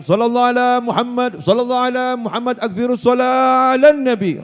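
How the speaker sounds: loud, high rallying cry of a habib; sustained, high-pitched shout to the congregation; shouted salawat. Acted. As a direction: call it out high and loud across the gathering. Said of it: "ada habib yang teriak".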